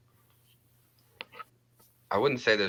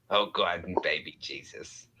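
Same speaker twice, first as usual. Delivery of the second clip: ordinary delivery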